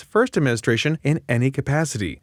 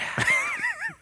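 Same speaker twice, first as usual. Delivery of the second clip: high laughing squeal; 7 dB quieter than usual. Real, not acted. Crooner bit breaks into laugh.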